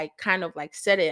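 normal talking voice

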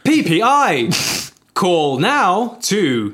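loud, slow ad announcer; hammed-up hotline advert; phone menu voice. Acting out automated sales call.

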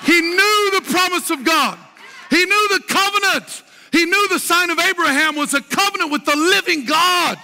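high, fired-up sermon delivery; full-force preaching. Real, not acted. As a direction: preach it boldly at a high pitch to a roused congregation.

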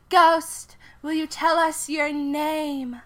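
high, slow, loud medium call; high, slow medium voice calling the ghost; summoning the ghost. Acted. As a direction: call into the dark like a seance medium.

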